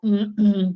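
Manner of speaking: one loud sharp throat clear as an example; demo throat clearing. Shown as a demonstration. Teacher demonstrates throat clearing to her class, loud and sharp. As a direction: clear your throat hard to show the class.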